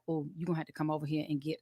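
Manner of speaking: imitating her dad on the phone. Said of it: "It's like".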